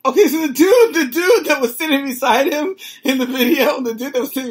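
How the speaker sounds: cracking up while describing the video; laughing. Real, not acted. Laughing at the crying clip.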